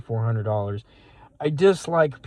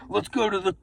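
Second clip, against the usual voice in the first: hyped passenger; 7 semitones higher than usual. Retold or imitated. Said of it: "He's like".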